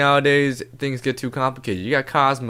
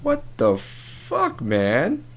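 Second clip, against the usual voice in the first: hurt disbelief; 2 semitones higher, much slower than usual. Acted. Co-host stung by a barrage of insults, hurt disbelief.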